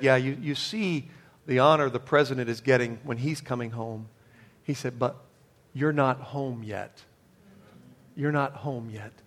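low, soft and tender, slowing at the end; gentle voice of the Lord. Retold or imitated. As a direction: speak like a comforting hand on the shoulder. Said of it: "put his hand on my shoulder and said".

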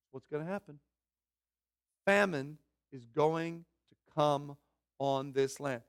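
normal talking voice

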